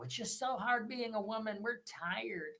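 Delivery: mock a weary woman's lament, hushed; sneering at tired women; mock complaint. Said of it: "You know".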